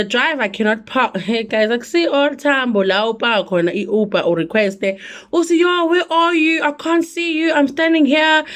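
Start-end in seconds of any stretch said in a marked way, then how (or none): 5.3-8.4 high, urgent where-are-you call; high-pitched retold phone plea; acting out a pickup call. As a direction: re-enact trying to find the driver, pitched up. Acting out finding the driver.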